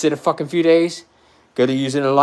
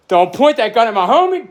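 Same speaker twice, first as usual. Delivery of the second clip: higher, louder warning to a cop; a pitched-up warning to back off, not a full yell; warning the cop away from his friend, raised and higher; retold warning; 8 semitones higher, 4 dB louder than usual. Second time, look.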